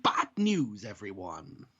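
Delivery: lightly voiced cartoon professor, dropping low on the last word; a poor attempt at a professor impression; Professor Farnsworth. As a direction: have a rough go at a cartoon professor announcement. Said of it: "put on my Professor Farnsworth voice".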